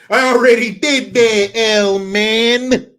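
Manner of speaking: put-on loud, high, indignant New York accent; brash put-on New York accent, full volume. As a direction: tell him off like a fired-up New Yorker. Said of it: "give us your New York accent".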